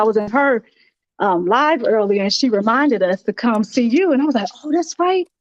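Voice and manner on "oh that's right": normal talking voice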